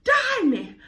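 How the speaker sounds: high, hammed-up mom voice scolding; mama's high mock scold at her sneaky daughter; imitating angry mama. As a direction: play the mother catching her daughter out, shrieking high. Mother catching her at friend's.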